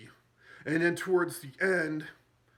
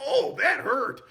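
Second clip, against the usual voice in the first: pained squeal; 11 semitones higher, 7 dB louder, slower than usual. Retold or imitated. Zed hand stepped on.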